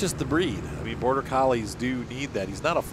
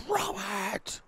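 retold scream; 13 semitones higher, 4 dB quieter than usual. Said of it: "And I screamed at him".

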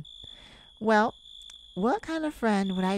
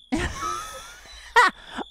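high squealing laugh; shrill cat laugh; 4 dB louder than usual. Acted.

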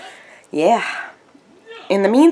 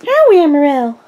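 high, loud sing-out of a name; calling out to pets; 8 semitones higher, 7 dB louder than usual. Real, not acted.

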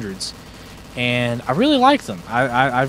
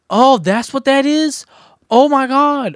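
excited shout; 14 semitones higher, 7 dB louder, much slower than usual. Real, not acted. Surprised excitement over new weapon.

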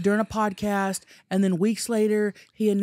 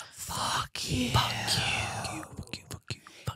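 whispered curse; hushed whispered curse; 3 semitones higher, 8 dB quieter, much slower than usual. Shown as a demonstration. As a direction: whisper it quietly. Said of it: "say it with us real quietly".

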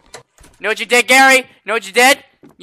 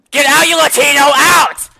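shouting get out; 5 semitones higher than usual. Acted. Cashier yells at the customer to get out. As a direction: yell him out the door.

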